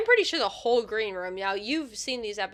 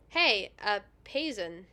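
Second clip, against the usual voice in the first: ordinary talk, nothing marked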